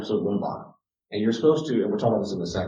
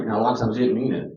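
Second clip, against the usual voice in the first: normal talking voice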